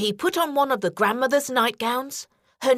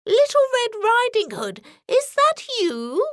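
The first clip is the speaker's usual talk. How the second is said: put-on old grandmother; 11 semitones higher than usual. A narrator's reading. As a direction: call out like a frail granny. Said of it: "asked the old woman".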